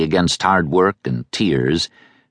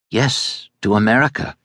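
usual speaking voice

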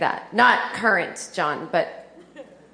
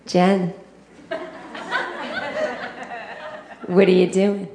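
put-on mother's Boston accent. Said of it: "she has a Boston accent".

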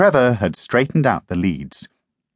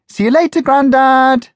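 high, loud call across the house; girl calling goodbye to her grandad; 6 dB louder than usual. Acted. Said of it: "Jackie called".

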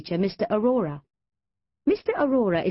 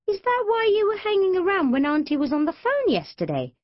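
higher, innocently probing; sly innocent question; innocent inquiry; 12 semitones higher, 3 dB louder than usual. Acted. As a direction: ask it all innocence, to needle. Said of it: "Jazz inquired".

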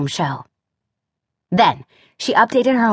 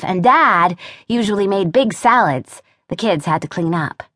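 plain reading voice

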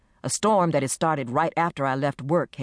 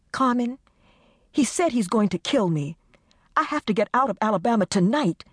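mild mock howl; 7 semitones higher than usual. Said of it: "Desiree's voice had risen to a howl".

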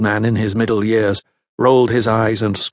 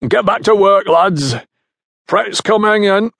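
high, loud call to the men below; raised, carrying foreman's order; 9 semitones higher, 4 dB louder than usual. A narrator's reading. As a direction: shout orders down through cupped hands. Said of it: "he called out to the men below".

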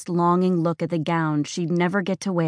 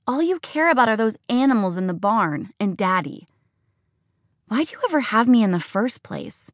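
raised, higher, accusing outburst; angry daughter; 4 semitones higher than usual. Acted. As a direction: lash out at your mother, hurt and furious.

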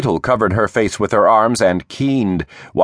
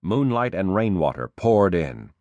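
plain reading voice

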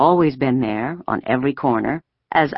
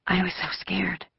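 hushed, much softer, higher voice; scared whisper; 4 semitones higher, 9 dB quieter than usual. A narrator's reading. Friend confiding to friend when almost home, scared whisper. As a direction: confide the fear under your breath. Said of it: "Ellen whispered suddenly".

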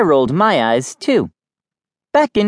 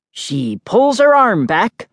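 lightly voiced, slow commentator; sports announcer; 5 semitones higher, slower than usual. A narrator's reading. Kid teasing sister with paddle as microphone, mock announcing. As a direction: build suspense like a mock commentator. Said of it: "I announced".

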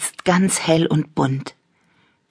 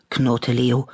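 usual speaking voice

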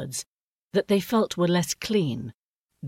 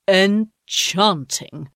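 admiring; 4 semitones higher, 6 dB louder, much slower than usual. A narrator's reading. Father admiring.

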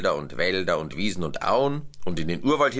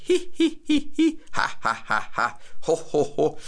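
very high hi hi sliding to ho ho; monkeys laughing; 8 semitones higher than usual. A narrator's reading. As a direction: cascade of animal giggles. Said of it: "alle Affen haben auch lachen müssen".

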